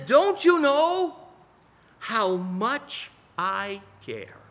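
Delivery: ache with love as you ask; God pleading. Pretend. God asking his people if they know his care, slow plea. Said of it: "God says".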